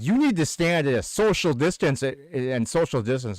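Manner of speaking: imitating a bossy stranger; mock scolding. Masked lady lecturing teller about distance, mock scolding. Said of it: "She's like".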